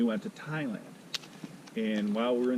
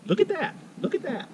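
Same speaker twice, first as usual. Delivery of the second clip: much higher quoted catchphrase; imitating Shirley. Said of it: "She loves saying".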